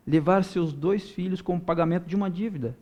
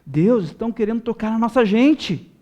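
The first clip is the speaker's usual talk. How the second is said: high-pitched, impassioned preacher's plea; building, insistent cry for the people; fervent prayer to God; 5 semitones higher than usual. Acted. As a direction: cry out to God, voice raised and urgent. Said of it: "irmos na direção de Deus e dizer".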